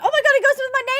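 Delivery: loud, high-pitched gushing; excited squeal. Real, not acted. Streamer finds cans match nails, loud excited squeal. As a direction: squeal it with delight.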